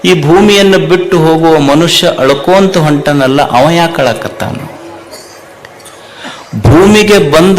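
loud, driving sermon cadence; fiery preaching. Acted. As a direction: preach it hard to the crowd. Preacher rising to full force. (ಜೋರಾಗಿ ಪ್ರವಚನ)

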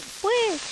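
high-pitched whoosh sound effect; mimicked whoosh. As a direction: make a swooshing speed noise. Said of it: "I was like".

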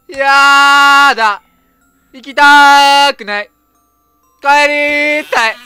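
put-on slow drawn-out child's whine; whiny child voice. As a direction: whine like a stubborn little girl. Dubs the girl refusing.

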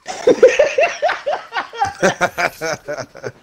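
shrieking helpless laughter; high screaming laugh. Real, not acted. Reacting to Cyclops' guns, shrieking laugh. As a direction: howl with laughter. Eyes holding guns line.

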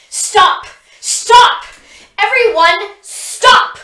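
very high, very loud bellow; commanding bellow. A narrator's reading. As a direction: freeze everyone with a roar. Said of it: "Pip bellows".

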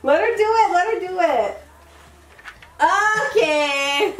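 high, loud squealing cheers; excited cheering. Real, not acted. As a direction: cheer the puppy on. Cheering dog on puzzle.

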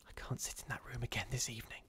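very soft, unvoiced whisper. A narrator's reading. Rebecca to Caroline after supper, whispering. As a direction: whisper it privately. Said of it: "she whispered to Caroline".

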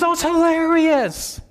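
put-on high, slow gushing teen girl voice; imitating his daughter. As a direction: mimic the daughter raving about her show. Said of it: "And she goes".

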